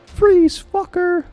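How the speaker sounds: very high, very loud order; cop shout. Acted. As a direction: bark at the clown to stop. Cop confronts clown.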